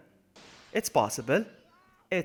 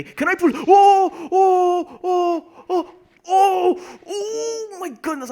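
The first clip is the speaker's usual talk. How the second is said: loud, very high squeals; overwhelmed screaming, ending in a drawn-out cry; ecstatic whooping; 10 dB louder, much slower than usual. Real, not acted. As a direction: shriek with laughing, disbelieving triumph. Thrilled whooping at pulling it off.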